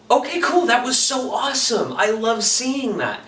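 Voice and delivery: high excited fanboy reaction; excited gush. Retold or imitated. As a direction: gush like you just saw something amazing. Retold excited reaction to movie.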